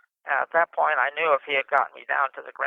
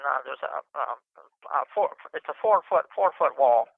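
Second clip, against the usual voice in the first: usual speaking voice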